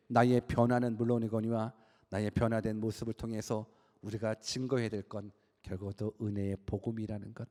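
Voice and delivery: normal talking voice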